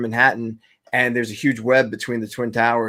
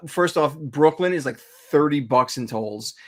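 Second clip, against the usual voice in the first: usual speaking voice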